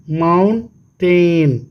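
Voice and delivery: slow "mountain" as two drawn-out beats, mount and टेन; syllable by syllable. Shown as a demonstration. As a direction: stretch and separate mount and टेन. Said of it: "कितना syllable हो गया जी". ("Mountain" धीरे, दो हिस्सों में खींचकर)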